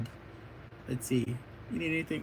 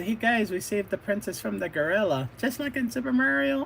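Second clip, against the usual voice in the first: high-pitched acted boast; excited announcement; 8 semitones higher than usual. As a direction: announce the rescue to the gang, rising higher. Kids announce rescue jokingly.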